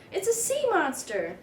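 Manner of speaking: high, alarmed cry; frightened shriek. A narrator's reading. Lady spotting a seaweed-covered dog, scared. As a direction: sound scared of the monster. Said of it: "she shrieked".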